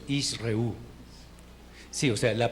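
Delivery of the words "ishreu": Ishreu with a silent first sheva, ish-reu; Hebrew pronunciation demo. Shown as a demonstration. Teacher showing students how Ishreu sounds, careful demo. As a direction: say Ishreu cleanly as the model word. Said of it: "esta es la pronunciación".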